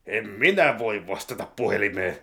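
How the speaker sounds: normal talking voice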